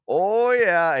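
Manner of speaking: high, loud "Oh, yeah" greeting; mock greeting. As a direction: sing out the greeting like an old pal. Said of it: "we go".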